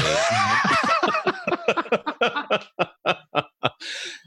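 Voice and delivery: long, high-pitched laugh; real laugh, high and trailing away. Real, not acted. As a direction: laugh at your own joke. Guest laughs at own joke.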